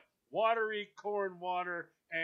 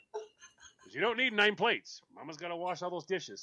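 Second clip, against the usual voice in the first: normal talking voice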